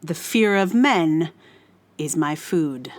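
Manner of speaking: ordinary talk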